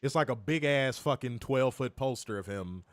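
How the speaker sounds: normal talking voice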